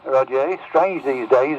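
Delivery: ordinary delivery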